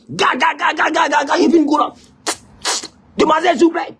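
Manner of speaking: high, excited call from a taxi window; a show-off flagging down a pretty girl. Retold or imitated. Man in a Yango calling girl.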